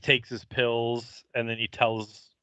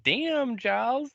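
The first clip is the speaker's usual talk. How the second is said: much higher, wowed damn Giles; impressed exclaim; 13 semitones higher, slower than usual. Retold or imitated. Fan reacting to Giles snapping a stake, high and wowed. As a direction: sell how impressed you were. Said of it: "I was like".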